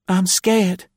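put-on very high, tiny girl voice; scared little girl. A narrator's reading. Frightened child to a bound stranger, high and timid. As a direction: squeak it out timidly. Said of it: "the little girl whispered".

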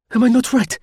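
very high, eager woman's voice; excited cry. Acted. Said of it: "she cried excitedly".

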